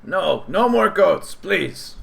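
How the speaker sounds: a fed-up goblin pleading; weary goblin plea. Acted. Goblin refuses goats.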